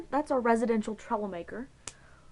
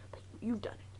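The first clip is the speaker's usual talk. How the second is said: softer, unvoiced knowing whisper; 2 semitones higher, 8 dB quieter than usual. Real, not acted. Teasing Jess that she surely cut herself, whispered. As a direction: whisper it like a secret jab.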